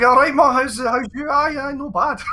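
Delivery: loud, very high broad Scottish chat; chirpy Scottish phone banter, pitched right up; reverting to broad Scots. Retold or imitated. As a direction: slip into thick Scottish with mum. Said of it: "quickly reverts back".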